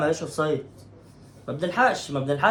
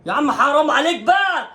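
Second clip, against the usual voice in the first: exasperated protest; 8 semitones higher, 5 dB louder than usual. Real, not acted. Protesting referee decision.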